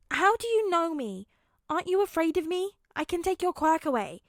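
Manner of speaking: put-on high, loud anxious questions from a child; a scared kid bracing for rejection. A narrator's reading. Frightened child Aizawa pleads.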